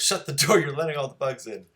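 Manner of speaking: high, loud bossy sibling call; calling from another room. Acted. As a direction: holler it at your sibling, pitched well up. Lucy calls from other room.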